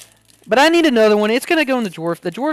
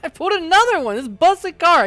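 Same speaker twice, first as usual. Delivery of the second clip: excited; 10 semitones higher, 6 dB louder than usual. Real, not acted. Excited about pulling the card again.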